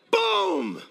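high, drawn-out shouted boom; explosive impact boom. Retold or imitated. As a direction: shout the blow landing. Imitates the elbow's impact.

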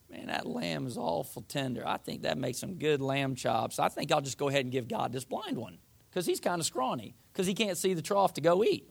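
low, casual muse to yourself; scheming offerer. Acted. Offerer talks himself into giving God the blind lamb, scheming. Voicing people choosing poor offerings.

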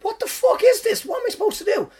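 very high, squeaky protest; exasperated disbelief. Retold or imitated. Comic sent back on by the boss, exasperated disbelief.

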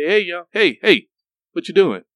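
loud, high-pitched hailing shout; calling out. Retold or imitated. Program director hails a boy cutting grass, calling out. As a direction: call out to get a kid's attention.

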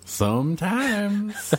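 slow, high stretched echo; high drawn out echo. Pretend.

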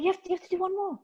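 high, soft urging voice; coaxing coach. Retold or imitated. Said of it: "he's like".

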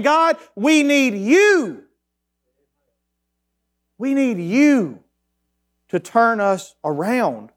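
very slow, high, stretched pleading; slow pleading prayer. Acted. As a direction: lean on each "you" like a cry to God. Pastor voices a pleading prayer.

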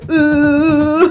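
retold wail breaking into laughter; mock tearful whimper. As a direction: let out a hammed-up "uh" near tears. Vill typ gråta ihjäl.

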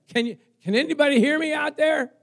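loud, high-pitched holler; crying out for help. Acted. Preacher acts Jonah trapped inside the fish, hollering for help. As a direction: call out as if trapped far away.

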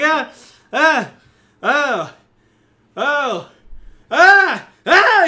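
repeated loud high screams; acted screams. Game master as goblin slapped awake in sand, screaming. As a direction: scream in separate bursts, climbing at the end. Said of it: "like, screams".

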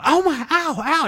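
high yelped ow ow, imitating a hurt kid; retold scream of pain. Kid hurt by a needle in the carpet, high mock yelp. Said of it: "just start screaming".

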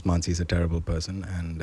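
dry mock aside. Host tells everybody about Mansi, hushed mock aside. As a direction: drop it down like a confidential aside.

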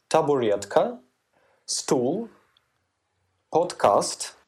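Russian words with an English accent. Shown as a demonstration. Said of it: "произносить их на английский манер".

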